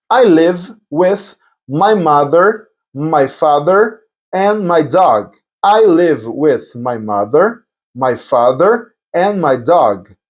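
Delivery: very slow, word-by-word language drill; slow model sentence. Shown as a demonstration. Teacher demonstrating an answer about family, very slowly. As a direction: give the learner time on every word.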